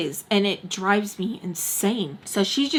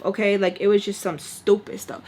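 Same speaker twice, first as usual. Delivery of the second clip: ordinary delivery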